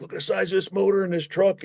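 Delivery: high, wide-eyed voice showing off the big motor; gee-whiz tour guide for a little boy; amazed kid talk. Retold or imitated. Trucker shows a kid the motor, high and amazed.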